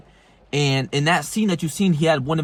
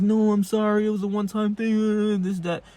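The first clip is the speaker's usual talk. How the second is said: higher, whiny imitation of a pleading girlfriend; mock tearful begging; 5 semitones higher than usual. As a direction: imitate her begging, half-hearted and high. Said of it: "she's crying, and begging him".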